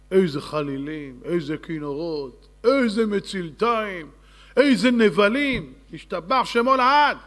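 grand announcing. Acted. Builds up the royal orchestra's splendour.

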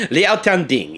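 scornful laugh into the name; mocking villain laugh. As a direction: laugh him off with contempt.

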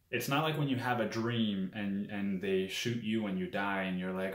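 plain reading voice

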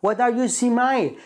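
mock Indian accent; drawn-out Indian English question; imitated Indian accent. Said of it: "لا تستطيع أن تقول smiling".

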